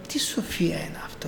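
a whispered marvel, almost to himself; awed whisper. Retold or imitated. Overhearing the brother on Zoom, he whispers in awe. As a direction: breathe it out in a hushed whisper.

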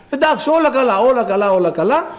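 lightly mimicked complacency, starting high; breezy dismissal. Preacher mimics someone without problems, breezy dismissal. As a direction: brush it off with repeated reassurance. Voices a complacent untroubled person.

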